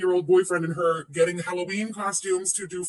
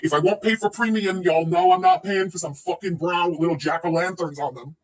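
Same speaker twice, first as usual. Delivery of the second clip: ordinary delivery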